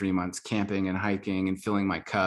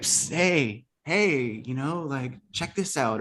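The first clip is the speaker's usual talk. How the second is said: heart's hustler voice; 5 semitones higher, slower than usual. Retold or imitated. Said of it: "a weird criminal-esque accent".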